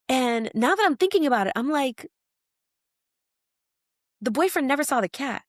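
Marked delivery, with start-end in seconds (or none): none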